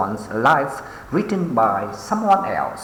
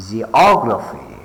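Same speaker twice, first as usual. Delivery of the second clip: geography with OG punched, then falling; ge-OG-raphy, leaning on OG; stress on second syllable; 4 dB louder than usual. Shown as a demonstration. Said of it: "pay attention to the stress syllables".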